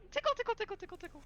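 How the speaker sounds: teasing high creature patter; hyena man Tickle. Acted. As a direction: squeal it while tickling someone. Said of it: "he, like, tickles you".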